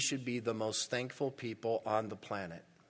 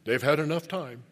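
indignant churchgoer grumbling, much louder; callous complaint; 2 semitones higher, 7 dB louder than usual. Retold or imitated. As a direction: bark it like a bitter long-time servant. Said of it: "would you be so callous as to say".

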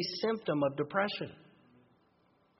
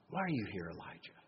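softly whispered, God gently calling Elijah; gentle whisper; 8 semitones lower, 13 dB quieter than usual. Acted. Preacher voicing God gently asking Elijah, whispered. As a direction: breathe it out tenderly, barely voiced. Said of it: "very gentle and patient".

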